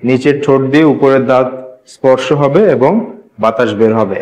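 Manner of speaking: normal talking voice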